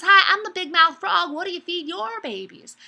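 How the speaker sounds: high, loud, friendly frog greeting; big-mouth frog. Acted. Frog greets an alligator, friendly as ever, big-mouthed. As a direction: call it out big-mouthed and oblivious. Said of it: "he speaks with a really big mouth".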